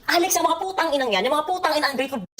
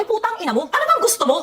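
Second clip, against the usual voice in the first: exasperated outburst; 7 semitones higher than usual. Real, not acted. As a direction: throw the question at her in anger. Exasperated scolding of Mikay.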